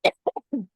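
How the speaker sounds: short throat-clearing cough. Real, not acted. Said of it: "I'm gonna do a little cough".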